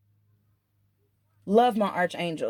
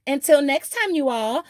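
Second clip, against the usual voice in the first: playful goodbye; 6 semitones higher, slower than usual. Real, not acted. Playful sign-off to viewers.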